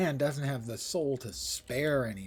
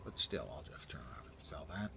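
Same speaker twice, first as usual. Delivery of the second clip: whispering; 9 semitones lower, 9 dB quieter than usual. Real, not acted. Whispered aside.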